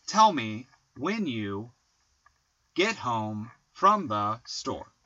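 very slow, TELL me WHEN you GET home; trochaic stress. Shown as a demonstration. Poet demonstrating trochees to co-host, trochaic stress. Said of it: "the trochee has stressed, unstressed".

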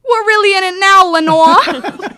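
put-on loud, high Boston accent. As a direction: shout it in a thick Boston accent. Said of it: "you just will hear someone".

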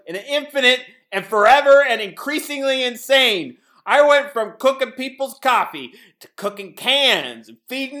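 building to a crazed shout; manic rant. Acted.

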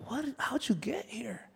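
high, hushed disbelief; drawn-out, half-whispered bewilderment; incredulous. Acted. Pastor voicing his thought at someone's downfall, hushed and incredulous.

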